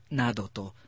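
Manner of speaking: plain reading voice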